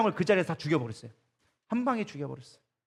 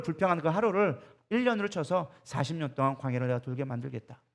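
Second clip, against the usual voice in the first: low, soft imitation of God's verdict; hushed, lowered divine voice, lightly acted; God passing sentence; 5 semitones lower, 5 dB quieter than usual. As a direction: drop low and quiet to pronounce judgment.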